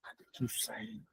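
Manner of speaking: breathless fretting under the breath; anxious whispered sigh; worried whisper. Retold or imitated. Worried believer voiced.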